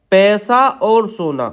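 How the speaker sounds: पैसा और सोना said slowly for dictation. Shown as a demonstration. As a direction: read it out slowly, word by word. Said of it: "पैसा आप ऐसे लिखेंगे". (धीमी गति से बोलना)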